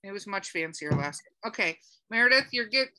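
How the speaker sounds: ordinary delivery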